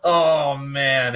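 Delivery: ordinary talk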